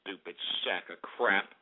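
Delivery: slow, very low, soft grumble; muttered curse. Real, not acted. Host cursing chatters after kicking them out, muttered. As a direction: curse under the breath, slow and deep.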